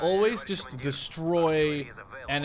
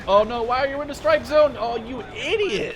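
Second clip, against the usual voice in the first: sudden loud, higher yell of disbelief; exasperated yell; 9 semitones higher, 8 dB louder than usual. Real, not acted. Player yelling at his own unit in the strike zone, exasperated shout.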